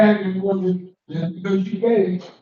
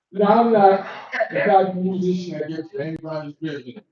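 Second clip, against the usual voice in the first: ordinary delivery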